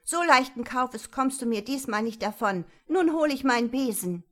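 loud, indignant threat; heated telling-off; angry scolding. A narrator's reading. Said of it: "zankte Marie weiter".

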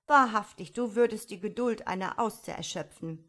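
loud, emphatic cheek; somewhat cheeky. A narrator's reading. Die junge Unke complains to her mother, cheeky. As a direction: burst out impatiently at a parent. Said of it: "sagte die junge Unke etwas naseweiß".

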